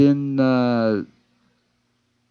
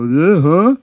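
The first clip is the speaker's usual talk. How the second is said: dopey reply; 6 semitones higher, 5 dB louder than usual. Retold or imitated. Workers answer dumbly.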